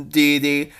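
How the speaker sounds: plain reading voice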